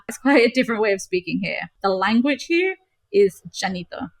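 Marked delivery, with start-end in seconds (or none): none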